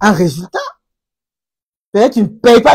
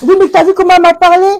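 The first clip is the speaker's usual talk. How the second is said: high, loud and fast mock complaint; imitating a whining spouse; whiny complainer; 8 semitones higher, 4 dB louder, much faster than usual. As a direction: mimic a petulant partner griping. Mimics a complaining partner.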